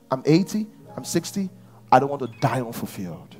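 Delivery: usual speaking voice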